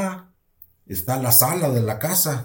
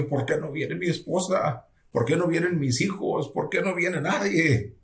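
higher-pitched, plaintive questioning; retold tearful pleading; 5 semitones higher than usual. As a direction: plead as if recalling your own tears. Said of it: "yo, hermana, lloraba". (súplica llorosa, recordada)

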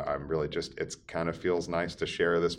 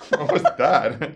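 laughing, slow and loud; asking through a burst of laughter; 9 semitones higher, 9 dB louder, slower than usual. Real, not acted.

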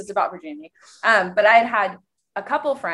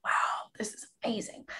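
breathy hushed wonder, softer; awed whisper; 2 semitones higher, 12 dB quieter than usual. Retold or imitated. Thinking about a new crush, hushed awed whisper. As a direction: whisper it as if you can't believe it. Retelling excited thought about guy.

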